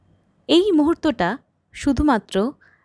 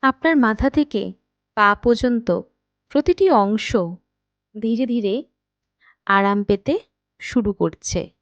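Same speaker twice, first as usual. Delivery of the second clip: guided meditation. Acted. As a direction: guide them unhurriedly into rest, phrase by phrase. Leads a guided night meditation. (ধীর লয়ে, থেমে থেমে ধ্যান করানোর কণ্ঠ)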